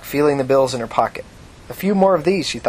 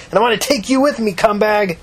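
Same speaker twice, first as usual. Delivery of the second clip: defiant scream; 8 semitones higher than usual. Acted. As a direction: keep screaming the threat. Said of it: "Tina screamed back".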